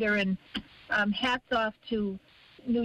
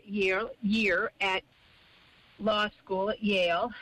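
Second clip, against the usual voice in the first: ordinary talk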